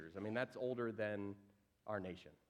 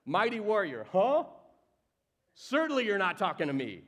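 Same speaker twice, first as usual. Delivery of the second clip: higher and louder, mock disbelief; incredulous Gideon; 6 semitones higher, 7 dB louder than usual. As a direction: scoff at being called a mighty warrior. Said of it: "This is what Gideon does".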